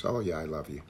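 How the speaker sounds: low, soft throwaway reassurance; offhand, going-through-the-motions endearment; perfunctory affection. Retold or imitated. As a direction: imitate saying it just to calm the waters. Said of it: "Just saying it because you know that'll".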